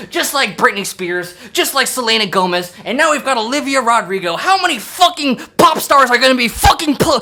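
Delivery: ordinary talk, nothing marked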